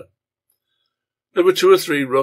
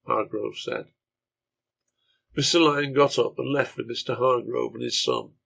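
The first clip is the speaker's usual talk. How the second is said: plain reading voice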